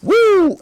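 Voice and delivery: loud high woo; excited whoop. Real, not acted. Excited cheer after the story.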